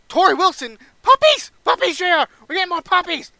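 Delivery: very high, loud frenzied squeal; Jerry Lawler going crazy. Acted. Commentator going crazy over Torrie Wilson, frenzied shouting. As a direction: shriek it like an overexcited commentator. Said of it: "Jerry Lawler goes crazy".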